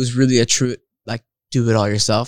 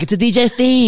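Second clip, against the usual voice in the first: much higher, put-on voice; mock posh drinker; 10 semitones higher than usual. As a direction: ham up a snooty drink expert. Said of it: "I'm like".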